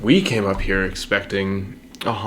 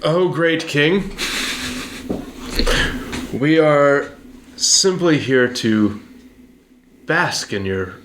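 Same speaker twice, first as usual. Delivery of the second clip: flattering courtier; 3 semitones higher than usual. Acted. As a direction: lay the flattery on thick. Flattering the king in character.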